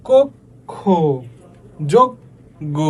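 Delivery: ক, খ, যোগ, গ as split syllables, "kok... kho, jog... go"; syllables under pressure. Shown as a demonstration. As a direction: stop hard on ক and যোগ, then release long খ and গ. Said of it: "আমাদের শ্বাসটা একটু মানে চাপ পড়বে".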